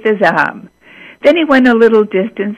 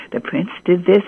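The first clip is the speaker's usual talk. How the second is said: ordinary delivery, nothing marked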